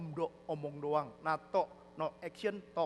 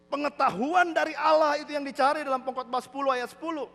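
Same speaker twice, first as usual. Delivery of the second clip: loud, raised pulpit voice; forceful preaching; 8 semitones higher, 8 dB louder, slower than usual. Real, not acted. Preacher urging congregation to seek wisdom, forceful. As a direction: proclaim it at full force.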